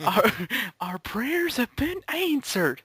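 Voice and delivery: high, slow, reverent mom; mock mom voice. Friends joking about a prank, mock thankful mom. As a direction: gush it like a thankful mother.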